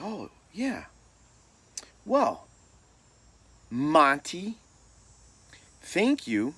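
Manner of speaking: high, loud, halting coach voice; coach covering mistake. Retold or imitated. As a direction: recover with pointed pauses, then order the player off. Coach covers mistake, orders player off.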